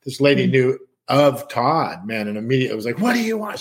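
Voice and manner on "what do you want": retold angry snap. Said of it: "She flipped".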